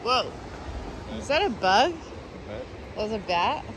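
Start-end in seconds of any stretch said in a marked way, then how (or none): none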